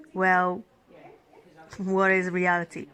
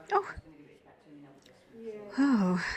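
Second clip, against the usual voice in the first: usual speaking voice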